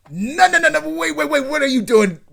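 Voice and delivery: high, loud panicked yelp; panicked protest. Retold or imitated. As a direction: squeal in alarm to stop her. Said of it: "Saito notices it and is like".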